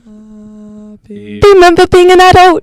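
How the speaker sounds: Salad Fingers voice. Pretend. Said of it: "It's like salad fingers happy birthday".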